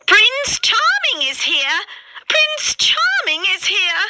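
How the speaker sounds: very high, excited squeal; ugly sisters' voice. A narrator's reading. Ugly sisters announcing the prince's arrival, squealing high. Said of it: "say the ugly sisters".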